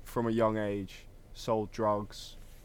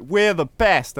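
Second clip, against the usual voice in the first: higher, louder mock brag; mock cocky friend; 8 semitones higher, 10 dB louder, much slower than usual. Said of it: "And Jamie's like".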